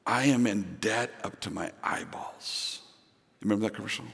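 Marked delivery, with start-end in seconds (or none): 0.0-2.8 low, quiet confession after a pause; hushed punchline; after a dramatic pause. Retold or imitated. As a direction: drop the voice and confess it. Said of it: "Dramatic pause".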